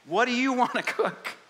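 high, loud, teasing God asking what you like; playful Lord voice. Acted. God playfully asking the believer what he likes to cook. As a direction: cheerfully nudge the believer to choose. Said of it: "the Lord's like".

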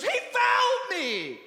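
high, loud, drawn-out protest; indignant full-voiced shout; outraged yell. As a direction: yell the grievance back at your trainer. Jockey yells back at furious trainer.

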